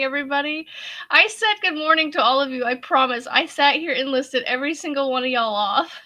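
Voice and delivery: ordinary talk, nothing marked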